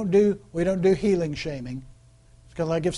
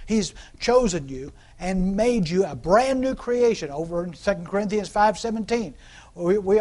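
usual speaking voice

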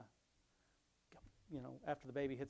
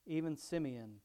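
louder, measured Bible reading voice; scripture reading; 7 dB louder than usual. Acted. Pastor reading Luke aloud to his congregation, louder reading voice. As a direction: read the passage out to the congregation.

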